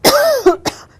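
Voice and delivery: a sharp high loud cough; real dry-throat cough. Real, not acted. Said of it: "She made my throat feel like a desert".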